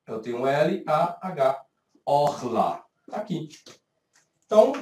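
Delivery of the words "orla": "orla" beginning on O, lower in pitch; pronounced with O. Shown as a demonstration. Teacher reads back the transliteration, "orla" with O. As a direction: sound out "orla" with O as first vowel. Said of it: "Então, eu tenho um O".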